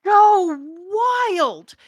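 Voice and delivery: high, loud burst of delight; excited exclamation. Real, not acted. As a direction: blurt it out thrilled. Excited reaction to emotional scene.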